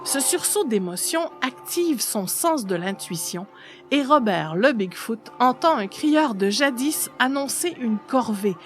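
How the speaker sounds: ordinary delivery, nothing marked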